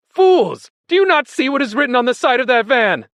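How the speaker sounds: high, loud, urgent berating; exasperated shouting. A narrator's reading. Benjamin berating the animals about the van, shouting. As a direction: shout it to make them finally look. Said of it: "shouted Benjamin".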